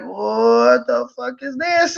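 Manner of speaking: slow, drawn-out retold amazement; stunned disbelief. Man retells first sight of the creature, stunned. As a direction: stretch out the words in disbelief.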